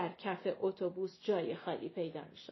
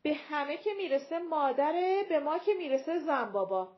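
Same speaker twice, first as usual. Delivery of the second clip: raised, higher complaining voice; said loudly; 5 semitones higher, 5 dB louder than usual. A narrator's reading. Young woman complaining aloud to the passengers, loud and higher. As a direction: call out the grievance for all to hear. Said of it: "زن جوان بلند گفت".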